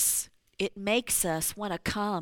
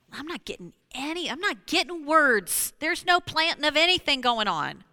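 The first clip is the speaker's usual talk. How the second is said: frustrated outburst; 6 semitones higher, 6 dB louder than usual. Real, not acted. Recalling frustration with God.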